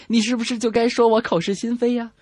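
ordinary delivery

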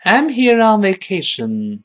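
high, slow, projected model sentence; loud teacher's demonstration; read out loud. Shown as a demonstration. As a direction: call it out slowly for the class to copy. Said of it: "跟老师大声读一遍".

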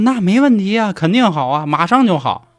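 high-pitched, eager mock reassurance; blithe agreement. A narrator's reading. Killer son answering his crying mother, blithe and high.

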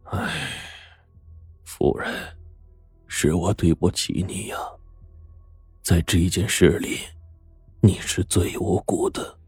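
sigh, then apologise quietly; sighing apology. A narrator's reading. Butler apologises to the wronged lady, sighing softly. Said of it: "管家叹了口气说".

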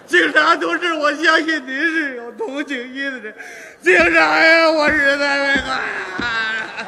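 hammed-up sobbing plea, loud and slow; loud mock wailing at the policeman, slow and drawn out; loud fake wailing. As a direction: wail it out to beg mercy. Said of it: "这就哭上了".

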